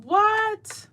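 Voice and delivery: high squeaked what in mock shock. Mocking men on cramp machines, high shocked squeak. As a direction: squeal it like a man suddenly hit by pain.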